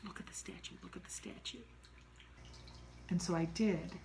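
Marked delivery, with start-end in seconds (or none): 0.1-1.6 breathy hushed whisper, urging; urgent whisper. Retold or imitated. Frightened friend whispering to the narrator about the statue, urgent whisper. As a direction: whisper it so the thing won't hear.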